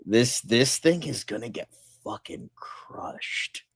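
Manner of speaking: slow ominous whisper; slow whispered doom. Real, not acted. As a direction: hush it like a dark prophecy.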